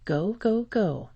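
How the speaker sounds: lightly voiced shouted command; mild narrated shout. A narrator's reading. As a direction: read a man's shout without really shouting. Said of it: "A man shouted".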